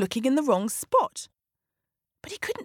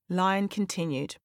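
ordinary delivery

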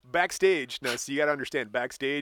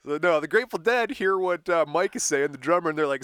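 normal talking voice

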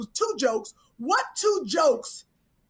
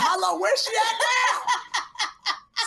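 laughing shout; 8 semitones higher, much slower than usual. Retold or imitated. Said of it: "I said".

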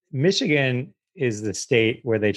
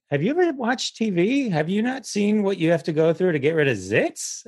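incredulous retort; 9 semitones higher than usual. Retold or imitated. Retorting to refusers.

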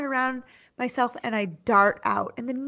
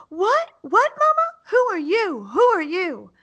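put-on much higher, childlike questions; little boy voice; 11 semitones higher, 4 dB louder than usual. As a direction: sound like a confused small boy. Son answering and asking.

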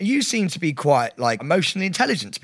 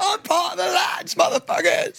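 laddish shout; 14 semitones higher than usual. Pretend. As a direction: bellow it like a rowdy lad. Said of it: "back to normal".

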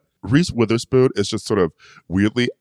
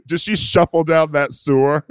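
laughing through it; 8 semitones higher than usual. Real, not acted. Joke while laughing.

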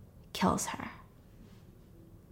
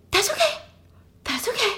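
very high, squeaky self-soothing; acted falsetto, trying to stay calm; panicky reassurance; 11 semitones higher than usual.

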